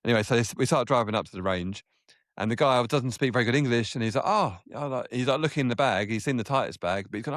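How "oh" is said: high, surprised little oh; a Mizuno man spotting the bag, surprised. Retold or imitated. Japanese guy reacting on the buggy, high surprised oh.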